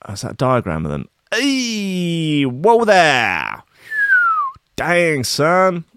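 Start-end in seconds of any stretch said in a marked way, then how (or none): 1.4-5.8 high, drawn-out squeal of dismay; long squeamish yelp; startled wince. Real, not acted. Reacts aloud to article's diagram.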